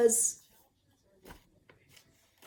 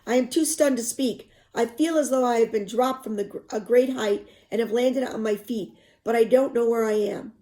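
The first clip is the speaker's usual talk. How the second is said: expressive read-aloud narration; story narration; 2 semitones higher, 5 dB louder than usual. A narrator's reading. Teacher reading a novel to students, expressive narration.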